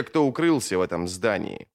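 ordinary delivery, nothing marked

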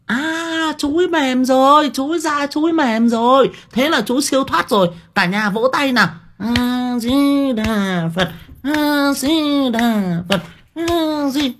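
very high, loud, singsong cheer; gushing temple lady. Retold or imitated. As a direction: gush over the death like a celebration.

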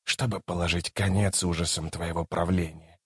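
soft, low, solemn god voice. A narrator's reading. Krul comes to end the tyrant's reign, softly solemn. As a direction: state the purpose gravely, hushed and low.

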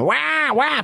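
put-on high wailing baby cry; baby crying wah. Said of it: "È il pianto del bambino".

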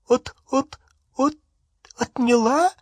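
very high, tearful stutter; acted high-pitched weeping; crying, stammering. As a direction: choke on the first syllable, aghast and weeping. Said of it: "снова заплакав, произнесла миссис Кроткот".

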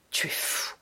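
whispered scorn; hissed. Acted. Mother dismissing son, hissed whisper. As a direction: spit it under your breath.

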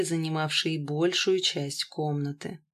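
ordinary delivery, nothing marked

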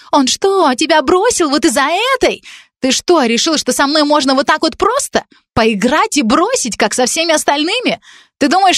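put-on high, accusing young woman's voice; a spurned girl throwing blame; jealous girl. Jealous college girl accusing Dan, high and bitter.

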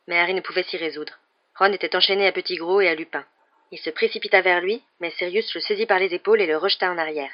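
plain reading voice

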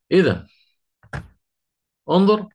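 normal talking voice

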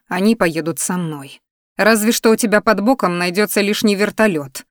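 plain reading voice

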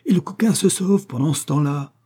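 high, urgent exclamation; exasperated high-pitched outburst; crying out. A narrator's reading. Cavalier to friend as the shooter escapes, crying out urgently. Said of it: "cria Vespasiano".

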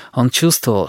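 usual speaking voice

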